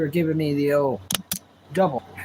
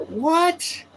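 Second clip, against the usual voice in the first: much higher startled what; retold shock, pitched way up; stunned what; 10 semitones higher than usual. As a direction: squeak it out in disbelief. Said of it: "I couldn't believe it".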